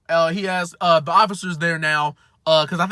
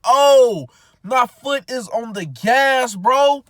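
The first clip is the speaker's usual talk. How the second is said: sudden realization; 8 semitones higher, 6 dB louder, much slower than usual. Retold or imitated. Realizing foot on gas.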